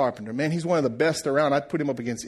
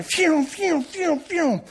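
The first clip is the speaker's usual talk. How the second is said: high, falling whizzes of a saw; imitated saw noise; 12 semitones higher, much slower than usual. Man telling how the carpenter's saw was flying, imitated saw noise. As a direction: mimic a saw zipping through wood.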